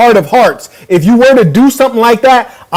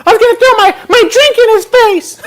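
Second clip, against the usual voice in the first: high laughing mock of Adam; mocking girlish quote. Host laughing at Adam, quoting him in a high girly voice. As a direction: squeak it out high while laughing. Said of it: "No one would have been able".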